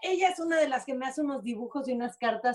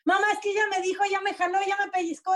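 put-on higher, tattling child voice, lightly done; imitating whiny kids; 8 semitones higher, faster than usual. Mother imitating her kids complaining to her, a light whiny higher child voice. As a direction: mimic a kid telling on a sibling.

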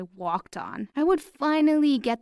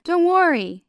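breezy reassurance; 9 semitones higher, 7 dB louder than usual. A narrator's reading. Brian reassures the scared narrator.

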